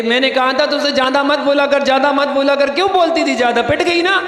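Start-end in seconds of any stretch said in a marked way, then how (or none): none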